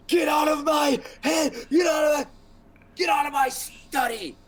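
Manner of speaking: high, loud, frantic wizard shriek; raving wizard. Acted. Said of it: "And he's like".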